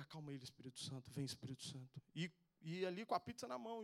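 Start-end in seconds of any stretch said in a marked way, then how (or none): 0.0-2.0 low, soft repeated invocation; retold prayer. Man praying over a screaming boy in the street, soft low prayer. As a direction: re-enact praying softly over someone. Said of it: "que me veio de orar".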